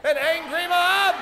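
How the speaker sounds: high, slow rising call; cue call. Shown as a demonstration. Host cueing the angry mob, high drawn-out call. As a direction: stretch the cue out for the crowd.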